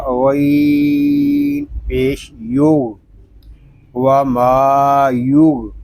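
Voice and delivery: slow, drawn-out letter-by-letter recitation; Quran spelling drill. Shown as a demonstration. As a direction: spell out each letter, stretching it slowly. (धीमे, खींचकर हिज्जे करते हुए)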